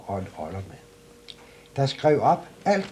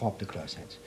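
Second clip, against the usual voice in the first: ordinary delivery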